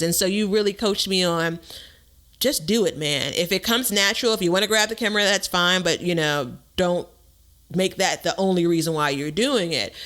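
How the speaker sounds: normal talking voice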